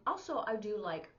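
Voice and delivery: ordinary talk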